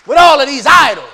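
loud, high burst of accusation; impassioned preaching shout. Acted. God accusing the people over idols, loud shout.

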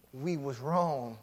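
soft, slow confession; remorseful brothers. Retold or imitated. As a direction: quietly admit the wrong, head bowed. Brothers admit guilt, voiced.

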